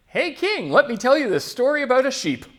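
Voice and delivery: high, eager storyteller's hail; calling to the king. Acted. Somebody comes in to tell the king a sheep story, eager hail. Acted visitor addressing a king.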